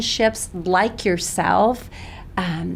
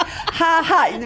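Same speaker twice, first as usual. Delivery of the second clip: burst of laughter; 9 semitones higher, 5 dB louder than usual. Real, not acted. Laughs at April Fool's opening.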